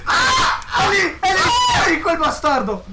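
high, panicked shouting; frantic yelling. Real, not acted. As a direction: yell in frantic fear. Frantic hunt for monster.

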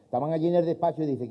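ordinary talk, nothing marked

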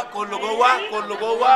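loud order. Acted. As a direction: order them all inside, firmly. Ordering everyone inside.

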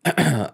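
clears throat sharply; a quick throat clear. A narrator's reading. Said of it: "Joe cleared his throat".

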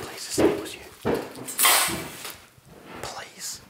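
urgent pleading whisper. Acted. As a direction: beg under your breath. Begging Reza secretly.

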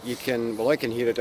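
normal talking voice

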